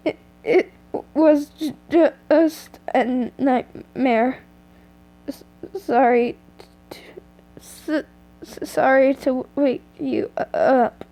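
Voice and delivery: tearful, halting words; sobbing apology after a nightmare; crying. A narrator's reading. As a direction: choke it out through tears.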